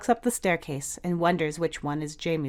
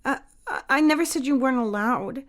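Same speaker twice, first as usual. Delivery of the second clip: flustered backpedal; 7 semitones higher than usual. A narrator's reading. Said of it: "Jamie backpedals".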